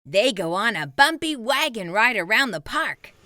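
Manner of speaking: ordinary delivery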